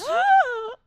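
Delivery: sharp, high mock gasp; dramatic gasp. Audience as Tombo falls, dramatic gasp. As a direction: suck in air in shock.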